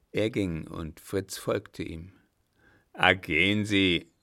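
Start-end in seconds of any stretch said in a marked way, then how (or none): 2.9-4.1 loud, gruff dismissal; calling after him. A narrator's reading. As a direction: call it after the departing man. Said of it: "rief ihm der Alte nach". (laut hinterhergerufen)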